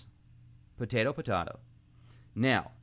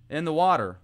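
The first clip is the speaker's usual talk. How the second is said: ordinary talk, nothing marked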